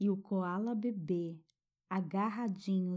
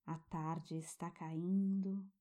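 guided meditation. Acted. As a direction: soothe a child toward sleep.

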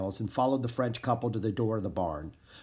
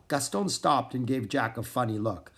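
usual speaking voice

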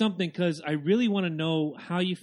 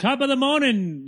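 loud, higher mock Irish greeting; mock Irish accent; 7 semitones higher, 6 dB louder than usual. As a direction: ham up an Irish accent like a wrestler. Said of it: "be like Sheamus and be like".

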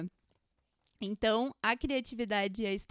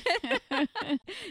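high laugh; 10 semitones higher than usual. Real, not acted. Laughs at the lesson title.

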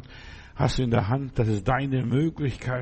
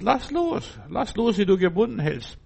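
high cry; 7 semitones higher than usual. Acted. Preacher cries out release.

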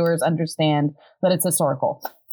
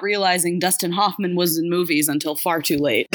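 normal talking voice

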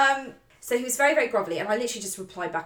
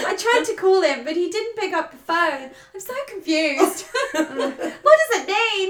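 mock Australian diary voice; 9 semitones higher, 5 dB louder than usual. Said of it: "I don't know why I'm turning Australian".

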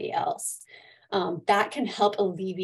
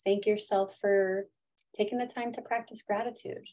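guided meditation; 5 dB quieter than usual. Acted. Leads a gratitude meditation.

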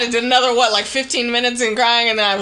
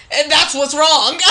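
retold crying; 7 semitones higher, 7 dB louder, slower than usual. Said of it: "'cause I can't stop crying".

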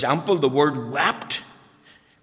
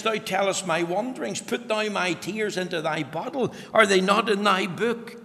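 higher, ringing quote of the verse; preached scripture; 5 semitones higher than usual. Acted. Pastor quoting the psalm back to his people, fervent. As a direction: recite it with the fire still on. Requotes text while preaching.

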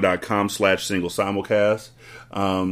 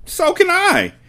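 a high, loud snap; snapped; 5 dB louder, slower than usual. Acted. Headmistress cutting Miss Honey down, high loud snap. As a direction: bite the words off. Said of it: "Miss Trunchbull snapped".

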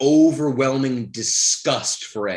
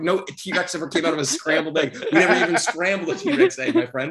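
laughing through the words; high laughing voice, cracking up; 5 semitones higher than usual. Real, not acted. Laughing while arguing.

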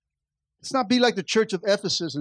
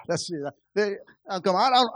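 normal talking voice

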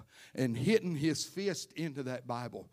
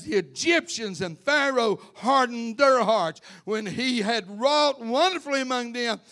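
loud, higher pulpit preaching; full-force preaching; 7 semitones higher, 9 dB louder than usual. Real, not acted. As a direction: drive the scripture home at full force.

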